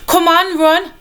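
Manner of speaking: high, loud urging to flee; urgent cry. A narrator's reading. Sophie urging Charles to flee, high loud shout. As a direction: shout at him to run. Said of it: "she cried".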